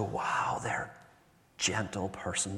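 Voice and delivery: breathy hushed whisper of admiration; admiring whisper. Retold or imitated. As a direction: whisper it in quiet awe. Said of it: "you just go".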